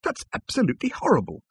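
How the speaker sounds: high-pitched outrage; appalled, squeezed up high; appalled exclamation. Acted. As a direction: exclaim in shocked disgust. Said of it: "exclaimed Arthur".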